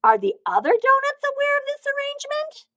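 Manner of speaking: very high, loud, aghast; a horrified donut questioning the baker. A narrator's reading. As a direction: ask it in outraged disbelief. Arnie shocked, voice very high.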